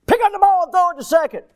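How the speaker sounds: high, loud, fast yelled order; dad's yell. Retold or imitated. Dad shouting orders to his son in the outfield, fast loud yell. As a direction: rush the boy with a shouted command.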